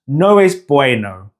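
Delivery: "No es bueno" English-accented, as noh ess BWAY-noh; beginner's English accent on "No es bueno", OH and AY glides. Shown as a demonstration. Teacher repeating the beginner's mistake, English-accented "No es bueno". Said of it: "with those O and A sounds".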